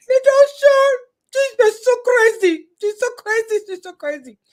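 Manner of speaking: high-pitched mock voice of the boss; mimicking the boss. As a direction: imitate your boss in a high, excitable voice. Mimicking boss talking about her.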